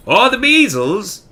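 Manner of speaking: a second doctor ruling out measles; specialist voice. A narrator's reading.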